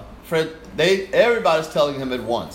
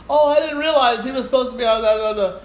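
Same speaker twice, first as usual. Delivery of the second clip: fawning conductor; 11 semitones higher, 6 dB louder than usual. Retold or imitated. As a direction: gush sweetly to win him over. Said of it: "he started fawning on me".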